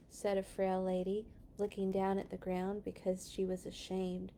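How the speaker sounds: usual speaking voice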